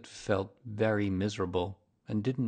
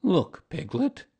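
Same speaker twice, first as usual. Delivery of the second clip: slow, high, louder, showing off; proud Eeyore; 8 semitones higher, 7 dB louder, slower than usual. A narrator's reading. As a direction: proudly draw a friend's attention. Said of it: "said Eeyore proudly".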